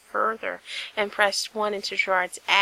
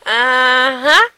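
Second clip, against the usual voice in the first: skeptical; 4 semitones higher, 13 dB louder than usual. Acted. Gerard disbelieving.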